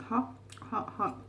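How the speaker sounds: hot food in mouth; huffing through a hot bite; mouth full of hot food. Real, not acted. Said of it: "hot food in mouth".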